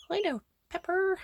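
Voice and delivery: high coo to a pet. Real, not acted. Owner greeting Pepper the chicken in a high pet voice.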